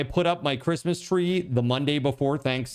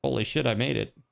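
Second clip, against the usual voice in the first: ordinary talk, nothing marked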